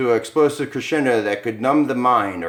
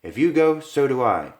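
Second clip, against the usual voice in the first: put-on higher, pleading young woman; lightly voiced Lisa; 6 semitones higher, 3 dB louder than usual. Wife to husband before the house, higher pleading voice. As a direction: voice her clinging to his hand. Lisa's voice in comic reading.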